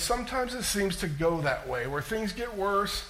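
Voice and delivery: usual speaking voice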